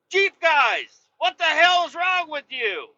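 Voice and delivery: exasperated rant. Acted. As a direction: demand an answer from the Jeep guys, worked up. Ranting at Jeep guys.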